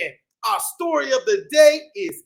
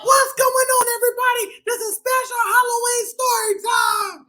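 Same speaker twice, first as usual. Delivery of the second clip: much higher, bouncy bee voice; bee puppet voice; 9 semitones higher, faster than usual. Acted. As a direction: play the little bee buddy. Puppet bee greets kids.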